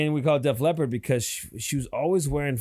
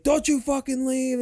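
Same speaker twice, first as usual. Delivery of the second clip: much higher, pleading angry cry; mock desperate yell; 11 semitones higher than usual. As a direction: voice her begging him to stay. Girlfriend hanging on car door.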